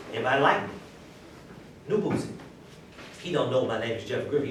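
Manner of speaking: usual speaking voice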